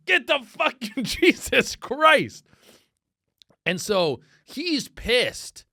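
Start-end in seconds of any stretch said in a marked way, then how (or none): 0.1-2.5 high, loud, slow imitated yell breaking into a laugh; mock yell. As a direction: yell it as the disgusted guy, laughing through it. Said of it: "And he's like".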